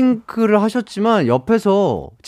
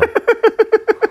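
high, helpless belly laugh; sudden big laugh; loud burst of laughter. Real, not acted. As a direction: crack up out loud at the joke. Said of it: "왜 이렇게 크게 웃으세요".

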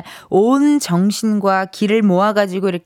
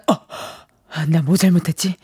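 nervous inner muttering; 4 semitones lower, faster than usual. Retold or imitated. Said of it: "이런 생각에 다들 긴장하실".

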